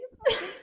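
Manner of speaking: high laugh breaking into a sob; a crying laugh that catches; teary laugh-sob. Real, not acted. Embarrassed by her tears, she lets out a high laugh-sob. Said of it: "I don't know why I'm crying".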